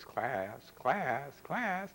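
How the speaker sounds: rising high call for order; hammed-up schoolteacher nun voice; nun teacher. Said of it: "she would yell a lot".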